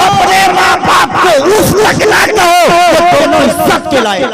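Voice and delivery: अपने माँ बाप को उफ़ तक ना कहो shouted high and loud, falling on तक; preaching shout. Shown as a demonstration. Preacher proclaiming the verse to the congregation, shouted. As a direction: cry out the command to the crowd. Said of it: "Quran कह रहा है".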